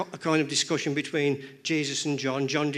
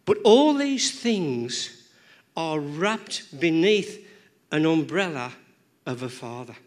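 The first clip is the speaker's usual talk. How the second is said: preacher landing the main point; emphatic preaching; 4 dB louder, slower than usual. Real, not acted. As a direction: drive each phrase home slowly. Preacher stressing key point.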